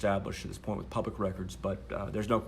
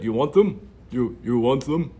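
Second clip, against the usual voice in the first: loud, slow, higher self-parody; self-mocking own voice; 4 semitones higher, 11 dB louder, slower than usual. Shown as a demonstration. Offering the logs to the reporter in a hammed-up self-parody. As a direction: ham up your own voice, dragging it out. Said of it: "That's what I sound like".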